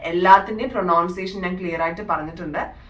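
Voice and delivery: normal talking voice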